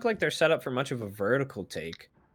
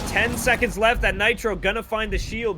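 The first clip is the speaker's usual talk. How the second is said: esports caster; 9 semitones higher than usual. Real, not acted. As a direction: build the play-by-play to a peak. Caster hyping the final clutch.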